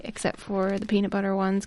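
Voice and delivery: ordinary talk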